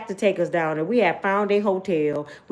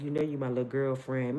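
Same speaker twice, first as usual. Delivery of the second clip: lower, softer sleazy voice; creepy older man; 7 semitones lower, 7 dB quieter than usual. Retold or imitated. Older man teasing grown woman, low and sleazy. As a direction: lay on the creepy charm.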